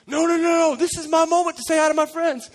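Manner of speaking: high, loud, insistent refusal; hammed-up indignant player; mock protest. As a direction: whine back at the coach over the noise. Distracted players refuse their coach.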